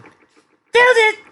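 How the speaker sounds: very high, piercing scream; shouting. A narrator's reading. Girl shouting again from the forest, high loud scream. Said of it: "Aurelia shouted again".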